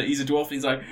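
ordinary delivery

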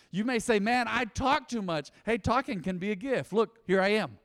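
ordinary talk, nothing marked

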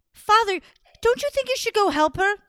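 high, pleading and fast; worried princess. Acted. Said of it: "Show me how worried she was".

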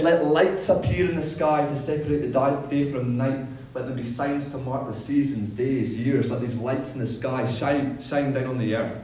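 big, loud voice of God; booming biblical narration. Acted. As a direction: proclaim it like scripture from the pulpit. Reading God's words aloud.

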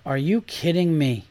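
usual speaking voice

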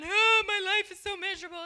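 whiny complaining kid, played for laughs; mock misery. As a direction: ham up a mopey teen. Mocking a moping young person.

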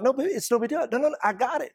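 high, doting dad voice insisting gently; a father waving off his daughter's refusal. Retold or imitated.

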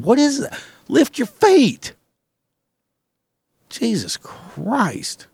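high, loud outraged squeal; mock shriek. Shrieking at a coworker in boots, high mock outrage. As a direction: squeal at the shuffler in disbelief.

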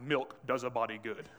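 a light touch of a commercial announcer; ad slogan voice. Retold or imitated. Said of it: "there was this ad".

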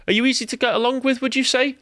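high, quick mock interviewer; interviewer's question. Asking a job candidate in an interview, high and quick. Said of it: "you ask in the interview".

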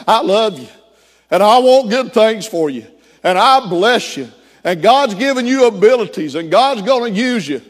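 a father turned revival preacher blessing his son; Pentecostal preacher. Retold or imitated. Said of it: "started sounding like a Pentecostal preacher".

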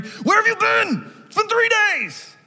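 high, loud, slow exasperated cry; high-pitched exasperated shout; mock angry mother. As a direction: play a frantic mother scolding her lost son. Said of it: "we sound angry".

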